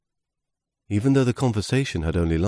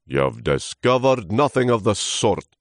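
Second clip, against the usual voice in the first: put-on loud, higher, dismissive old trunk; scornful snort; 6 semitones higher, 5 dB louder than usual. Old trunk dismissing leaf's discovery, snorting. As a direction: snort it out in contempt. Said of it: "snorted the old trunk".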